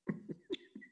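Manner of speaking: a breathy, voiceless little laugh; soft laugh. Real, not acted. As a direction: laugh quietly at the memory. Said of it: "I'm laughing because Margot".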